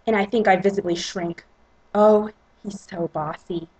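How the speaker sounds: plain reading voice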